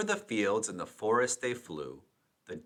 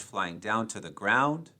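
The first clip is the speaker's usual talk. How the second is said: plain reading voice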